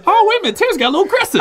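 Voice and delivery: high, mock-shocked; mock surprise. Mock outrage at Terrence's elbow.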